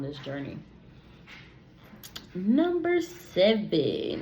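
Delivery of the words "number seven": high, loud, slow announcement; announcer. Real, not acted. Host announcing the next question, game-show style.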